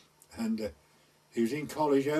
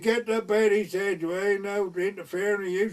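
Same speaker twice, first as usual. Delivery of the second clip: louder and higher, mock drunken shout; a hammed-up tipsy lad yelling up; drunk retort; 7 semitones higher, 5 dB louder than usual. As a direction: bark back at the old lady from the street.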